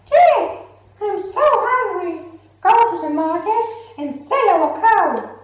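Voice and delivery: high, loud pleading mother; a hungry mother begging her son; mother's voice. Acted. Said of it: "Jack's mother said".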